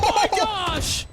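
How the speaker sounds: high, drawn-out hype yell; excited shout. Real, not acted. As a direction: scream it with pure disbelief. Caster reacting to clutch play.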